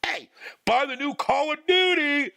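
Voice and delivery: high, slow, over-sweet ad announcer; mock a cheesy in-game ad; sing-song sales pitch cutting into gameplay. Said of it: "cutting your fucking gameplay off and be like".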